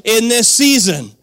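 preaching at full force. Acted. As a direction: fire up the congregation.

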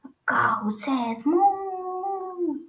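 high, slow, drawn-out moo; megaphone blare. A narrator's reading. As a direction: blare it out like a garbled toy megaphone. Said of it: "this time the megaphone blared".

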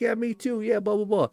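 high, airy agreement; breezy reply. Retold or imitated. Chatting with the sock girl, high and breezy. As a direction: brush it off cheerfully. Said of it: "I'm like".

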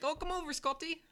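high-pitched, badly faked Irish accent; a hammed-up, squeaky Irish lilt calling a dog. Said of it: "That was a bad accent".

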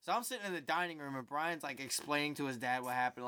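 ordinary talk, nothing marked

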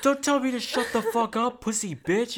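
high, loud mock scolding; mock angry retort. Girlfriend snapping back at boyfriend, mock angry retort.

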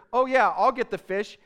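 loud, high, eager Peter jumping in. Retold or imitated. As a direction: shout it out like he can't wait to help.

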